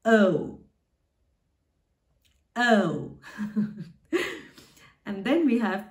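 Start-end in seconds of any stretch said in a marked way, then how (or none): 0.1-3.2 "O" said as German ö; drawn-out German ö on "O"; O umlaut. Shown as a demonstration. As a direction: say "O" twice as a long falling ö, with a pause. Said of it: "that's pronounced as".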